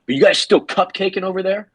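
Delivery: high-pitched hostile prying; retold hostile question. Tony checks on the couple, mock hostile and high. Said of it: "he's very hostile when he says it".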